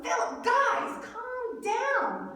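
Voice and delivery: slow, high-pitched appeal to settle down; drawn-out calming plea. Retold or imitated. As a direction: stretch the words to calm a panicking friend. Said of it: "he's telling him".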